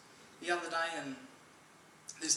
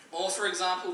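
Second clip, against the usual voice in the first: ordinary talk, nothing marked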